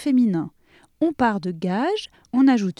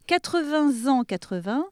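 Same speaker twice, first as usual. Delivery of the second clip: Quatre-vingts ans with a Z liaison, then quatre-vingts alone; teacher's model. Shown as a demonstration. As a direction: say quatre-vingts ans so the class can repeat. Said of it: "on répète après moi". (modèle de prof pour la liaison)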